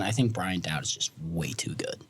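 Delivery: hushed whisper. Real, not acted. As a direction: whisper it low. Prediction aside.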